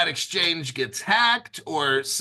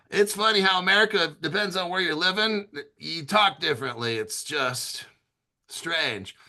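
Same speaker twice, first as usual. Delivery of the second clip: usual speaking voice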